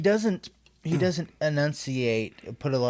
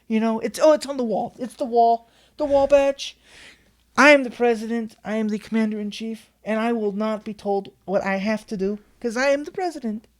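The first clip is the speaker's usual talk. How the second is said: higher, hammed-up Trump with a heavy Italian flavour; Trump impression; 7 semitones higher, 3 dB louder than usual. Trump refusing the aide's war orders, brash mock impression. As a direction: play Trump brushing off the aide, pitched up and brash. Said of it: "a lot of Italian in that, that Trump voice".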